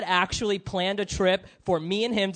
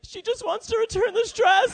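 put-on very high, meek little-kid voice; child translator; 13 semitones higher, faster than usual. Small child translating for mom at the register, high polite squeak. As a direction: pipe up as the embarrassed small go-between. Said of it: "And I'm just like".

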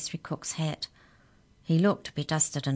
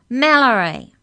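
high, very loud shout of a name; louder shout; 8 semitones higher, 13 dB louder than usual. A narrator's reading. Mallory corrects the giant who misheard, shouting louder. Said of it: "even louder".

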